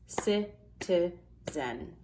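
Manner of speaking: slow, split into syllables; clapped syllables. Shown as a demonstration. Teacher claps out syllables for third graders, slowly. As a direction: drag "Citizen" out syllable by syllable. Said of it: "Let's clap out that to find how many syllables there are".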